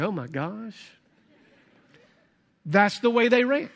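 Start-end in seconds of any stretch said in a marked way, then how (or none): 0.0-0.9 soft, low mock shock; shocked gasp. Preacher voicing the crowd's shock, hushed. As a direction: play the scandalized listener under your breath. Congregation's shocked reaction voiced.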